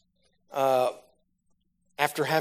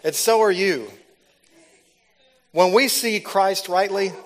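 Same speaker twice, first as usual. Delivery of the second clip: loud, higher fiery preaching; full-force preaching; 7 semitones higher, 7 dB louder, slower than usual. Acted. Preacher exhorting the congregation, loud and fiery. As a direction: drive it home to the crowd.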